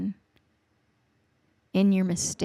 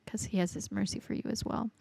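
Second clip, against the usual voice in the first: whisper; 9 dB quieter than usual. Real, not acted. Tender sermon on God's love.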